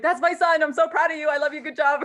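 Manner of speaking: mother gushing about her son on stage; proud mom. Retold or imitated.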